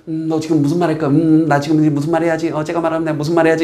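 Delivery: level, monotone thinking-to-oneself voice; acted inner monologue. Listener busy planning the next turn, acted inner muttering. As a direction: run through the thoughts on one unvarying pitch.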